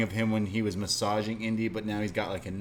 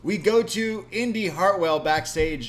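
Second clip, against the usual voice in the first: announcer intro; 8 semitones higher, 6 dB louder than usual. Acted. Podcast host to listeners, hammed-up announcer voice. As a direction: announce the next segment like a ring host.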